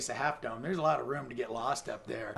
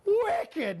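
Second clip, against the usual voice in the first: much higher, louder exclamation; impressed kid; 5 dB louder than usual. Retold or imitated. Bellhop kid reacts to the bloody guest with a thrilled high shout. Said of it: "And he's like".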